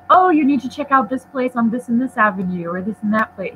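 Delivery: ordinary talk, nothing marked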